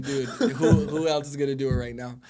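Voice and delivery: laughing through a retold question; amused, laughing reply to the coach. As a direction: chuckle the words out in disbelief. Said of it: "And I started laughing".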